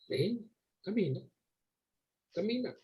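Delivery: gentle call. Acted. Jesus calls Pedro onto water.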